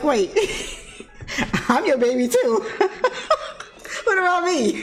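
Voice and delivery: put-on high kid's protest breaking into laughter; laughing mock plea. Five-year-old reminding frantic mom he's her baby, laughing mock plea. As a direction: play the overlooked child while cracking up.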